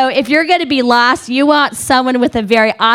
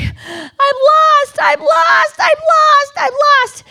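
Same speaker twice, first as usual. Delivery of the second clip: high-pitched panicked wail; comic hysterical squeal; much slower than usual. Retold or imitated. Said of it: "I'm starting to get hysterical".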